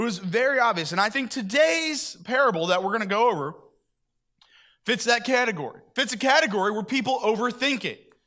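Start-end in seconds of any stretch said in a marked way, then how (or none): none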